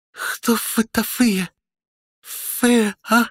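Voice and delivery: high, slow garbled infant voice; babbling baby. A narrator's reading. Baby addresses the dragons, high slow garbled babble. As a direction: babble slowly like a newborn trying words. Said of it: "членораздельная, хотя и абсолютно непонятная речь".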